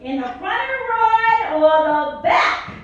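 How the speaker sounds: hammed-up giddy mother; sing-song mom. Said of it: "She goes".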